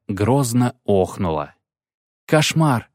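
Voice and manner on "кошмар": a startled, higher gasp of horror; startled cry. Acted. Dandelion shudders at the priest's claim, startled. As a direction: flinch at the word. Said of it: "вздрогнул Лютик".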